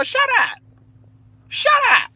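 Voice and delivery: high, loud angry "Shut up"; angry shout. Real, not acted. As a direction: yell it down at him, fed up. Ranting at rapper who sued.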